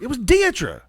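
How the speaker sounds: high-pitched retold protest; mock-exasperated defense; defensive protest. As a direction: insist on it, voice jumping up. Retold defensive answer in quarrel.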